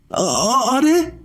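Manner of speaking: high, chirpy hesitant yes; a small bird shyly answering; bird's high, hesitant reply; little bird voice. A narrator's reading.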